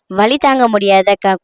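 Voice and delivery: ordinary delivery, nothing marked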